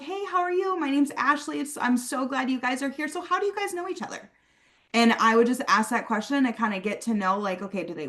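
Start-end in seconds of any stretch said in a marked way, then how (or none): 0.0-4.3 high, bright friendly hello to a guest's friend; bubbly greeting. Shown as a demonstration. Ashley greeting a new guest, high and cheery. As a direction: greet them like you're thrilled they came. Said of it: "I was like".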